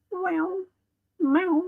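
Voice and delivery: high playful meow; cat meow. Pretend. Greeting Zoe the cat in the chat with a high meow.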